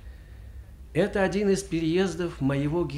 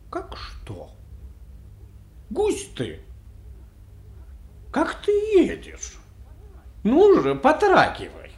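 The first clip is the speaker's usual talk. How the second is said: scolding that builds from hushed to loud and high; annoyed master; 11 semitones higher, 6 dB louder than usual. A narrator's reading. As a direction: rouse a dozing coachman with mounting impatience. Master scolds dozing coachman. (раздражённый окрик барина, по нарастающей)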